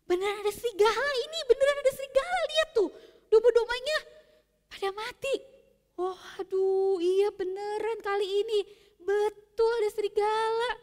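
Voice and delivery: stunned at finding the dead sheep; shocked villagers. Acted.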